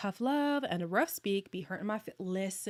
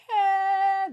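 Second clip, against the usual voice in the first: high, loud startled shout; 12 semitones higher, 11 dB louder than usual. Retold or imitated. Recalling the punishment that day, a high loud shout. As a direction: yelp it like a scolded kid. Dad's reaction retold.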